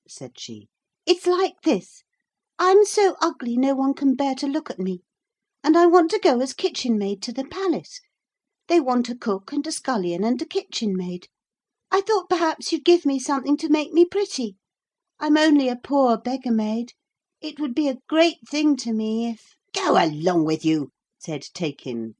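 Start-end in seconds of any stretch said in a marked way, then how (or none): 1.0-19.5 put-on high, eager, partly fast pleading girl; pleading beggar maid. A narrator's reading. Princess pleads to magician. 19.7-20.9 very cross. A narrator's reading. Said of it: "very cross indeed".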